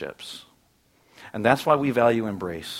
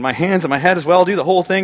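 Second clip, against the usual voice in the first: louder, higher, all-in excitement; eager overreach; 6 semitones higher, 8 dB louder than usual. Retold or imitated. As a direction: go all in like Peter. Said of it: "He's an extremist".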